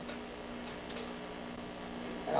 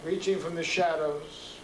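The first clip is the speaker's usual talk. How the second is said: slow, measured poem reading with long pauses; poetry reading; 2 semitones higher than usual. Acted. Poet reading his street poem to a live audience. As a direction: let each image land, then pause.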